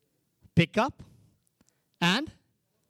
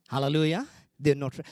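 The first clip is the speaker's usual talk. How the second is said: ordinary talk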